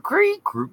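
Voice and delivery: high-pitched squeaky creak; tiny high creaking noise; imitated floorboard creak. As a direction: squeak like an old board underfoot.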